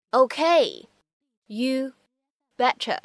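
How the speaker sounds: Okay, you and betcha each said slowly with pauses between; slowed for learners. Shown as a demonstration. Teacher to students, slow word-by-word model. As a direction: break it into slow single words.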